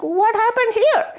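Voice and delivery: high, wide-eyed curious voice; a bright childlike question; curious goddess. Acted. Parvati asking Shivji about the celebration, high and curious. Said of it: "Parvati is like".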